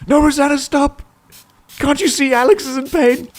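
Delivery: very high, panicked protest; squealed mock-frantic plea; mock pleading. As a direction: beg them to stop in hammed-up distress. Pleading with people in video.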